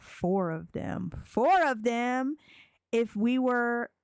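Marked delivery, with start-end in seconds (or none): 1.2-2.4 higher, drawn-out disbelieving question; taken aback, pitched up; incredulous repeat. Real, not acted. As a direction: echo it in disbelief, stretching it out. Player reacts to his wish.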